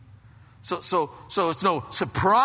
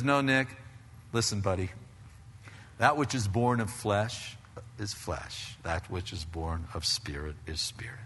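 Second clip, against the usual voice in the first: gentle, lowering and softening as it goes; gracious, kind; 6 semitones lower, 7 dB quieter than usual. Acted. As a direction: reason kindly with a friend, settling into a soft low voice. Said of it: "Gracious, kind".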